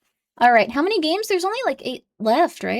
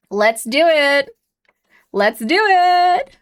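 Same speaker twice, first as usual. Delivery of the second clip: slow, deliberate, louder with a rising end; slow drawn out; 4 semitones higher, 4 dB louder, much slower than usual. Acted. Daring chat to make everyone angry, slow and drawn out. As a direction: savor it like a villain.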